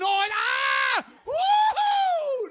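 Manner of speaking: high, loud ecstatic yell; hammed-up loud pleasure cry; mock sex yell. Imitating sex noises.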